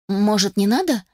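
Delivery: ordinary delivery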